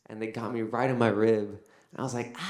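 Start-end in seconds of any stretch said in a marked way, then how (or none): none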